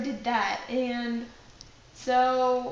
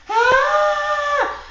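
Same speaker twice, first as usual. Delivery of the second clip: high, very loud scream of fright; terrified scream; 13 dB louder than usual. Retold or imitated. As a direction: scream as if ambushed from behind. Mom's frightened scream acted out.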